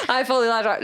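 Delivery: laughing through the words. Real, not acted. Joking to her friend about lashing out, laughing. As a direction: giggle out a guilty confession.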